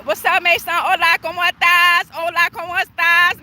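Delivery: normal talking voice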